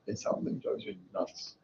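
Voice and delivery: normal talking voice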